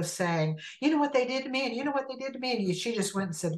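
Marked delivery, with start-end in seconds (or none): 0.8-2.6 high, whiny complaint; mock griping. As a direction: imitate someone griping about being wronged. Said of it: "She didn't go griping about them".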